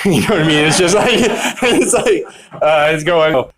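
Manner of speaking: can't help cracking up at the memory; laughing at himself. Real, not acted. Laughing at himself while telling his sobriety story.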